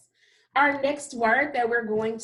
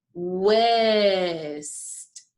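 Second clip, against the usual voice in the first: said slowly; 2 semitones lower than usual. Shown as a demonstration. Teacher saying the word slowly with the class. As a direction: stretch west out slowly with snakes out. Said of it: "let's say it slowly".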